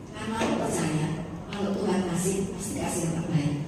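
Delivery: low, soft, assured reply; calm husband. Retold or imitated. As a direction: imitate a husband quietly trusting. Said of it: "dengan sangat tenang".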